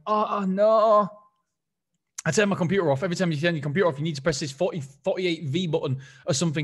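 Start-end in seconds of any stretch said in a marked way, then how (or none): 0.1-1.1 slow, drawn-out oh no; realising the mic was never on; dawning realisation. Retold or imitated. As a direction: let the penny drop slowly. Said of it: "I'm like".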